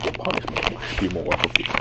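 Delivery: voiceless masked villain voice; Bane. Shown as a demonstration. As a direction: demonstrate a villain impersonation on breath. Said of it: "Try sounding like Bane".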